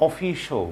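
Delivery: "অফিশিয়াল" with the ending cut short to "sho"; correct pronunciation. Shown as a demonstration. Teacher repeats the corrected word, correct pronunciation. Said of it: "শিয়াল না বলে শো".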